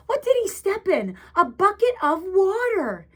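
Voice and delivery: plain reading voice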